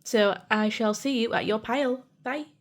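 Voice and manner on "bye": usual speaking voice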